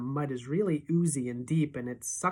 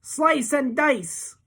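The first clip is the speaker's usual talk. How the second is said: much higher, very slow sneering thug voice; menacing bully; 10 semitones higher, 5 dB louder, much slower than usual. A narrator's reading. Gang leader reaching for his knife: slow sneering threat. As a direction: drag out the threat with relish. That's Blade.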